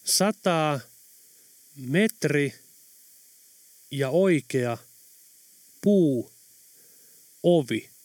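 one word at a time with long pauses; deliberate, word-by-word decoding; spelling out words haltingly. A narrator's reading. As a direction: read out each word separately as you decipher it. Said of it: "alkoi mutisten tavata alleviivattuja sanoja".

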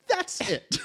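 high, emphatic That's it. Real, not acted. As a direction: land it like a final verdict. Emphatic final declaration.

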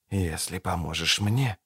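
low conspiratorial whisper. A narrator's reading. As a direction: lean in and whisper. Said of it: "шепнул Кайлей".

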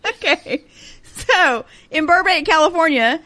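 host style restart; game show host. Pretend. Redoing host announcement.

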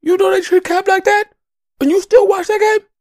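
a freed slave scolding his brother; mock outraged ancestor. Runaway slave scolding a fan who still watches games, high and loud. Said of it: "he'd be like".

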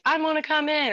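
high, silly ghostly tease; mock spooky teen trick; goofy spooky voice. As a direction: play a goofy prank voice. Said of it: "you know, goofy".

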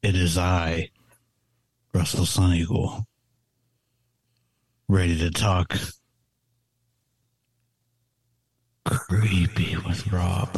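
a hint of a spooky host; creepy voice. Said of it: "I'm gonna use my creepy voice".

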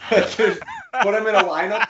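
laughing through the words. Real, not acted. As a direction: laugh while saying it.

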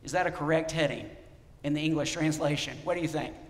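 ordinary talk, nothing marked

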